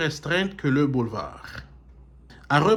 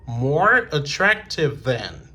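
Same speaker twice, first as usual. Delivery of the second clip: slow model; slower than usual. Shown as a demonstration. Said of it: "models comparative slowly".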